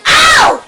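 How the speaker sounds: sharp high shouted ow; real yelp of pain. Real, not acted. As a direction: yelp as the teeth sink in. Dog bites him.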